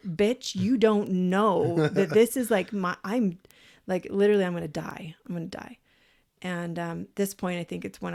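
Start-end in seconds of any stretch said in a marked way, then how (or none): 0.1-4.4 exasperated inner outburst. Retold or imitated. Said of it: "And I'm thinking". 4.4-5.8 whispered dread; terrified whisper. Retold or imitated. Replaying her panicked thought at the ceremony, whispered in dread. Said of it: "And I'm thinking".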